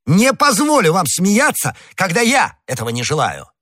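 high, indignant outburst; heated exclamation. A narrator's reading. Gascon forbidding the stranger to laugh, heated. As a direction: forbid him in a high, angry cry. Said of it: "воскликнул Д'Артаньян".

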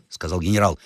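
ordinary delivery

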